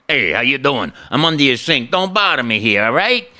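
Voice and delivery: gruff-tempered Brooklyn plumber, rising at the end; Brooklyn Italian plumber. Acted. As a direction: brush them off like a busy Brooklyn plumber. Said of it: "Italian plumber from Brooklyn".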